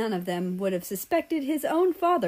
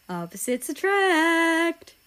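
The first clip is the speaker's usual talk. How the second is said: sing-song tease; 10 semitones higher, 4 dB louder, slower than usual. Acted. Albania's teasing remark.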